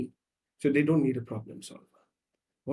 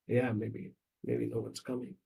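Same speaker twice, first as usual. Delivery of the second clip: low, soft and fading; subdued, defeated murmur; resigned little boy; 3 semitones lower, 7 dB quieter than usual. Acted. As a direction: give up quietly on being comforted. Acted boy giving up on comfort.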